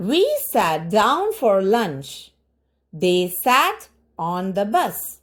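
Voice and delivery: each word spaced out like a teacher reading to a class; slow, careful classroom model reading; slow drill reading. Shown as a demonstration. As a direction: read every sentence very slowly and clearly. Teacher reads drill sentences slowly.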